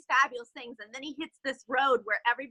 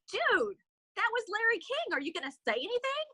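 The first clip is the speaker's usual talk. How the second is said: excited friend; 8 semitones higher than usual. Retold or imitated. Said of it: "his friend says".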